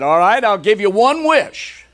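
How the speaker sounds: high, loud booming genie. Retold or imitated. Genie voiced in told joke.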